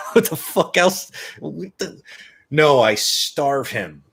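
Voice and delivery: high, loud mock outrage; incredulous sarcasm. Dog owner answering a stupid question, exasperated sarcasm. Retold incredulous comeback to stranger.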